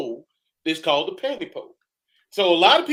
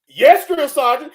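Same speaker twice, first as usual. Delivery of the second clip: higher, louder, slow sound-off; 8 semitones higher, 9 dB louder than usual. Retold or imitated. Recruit answers the drill sergeant, slow and loud. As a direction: drag out the answer loudly.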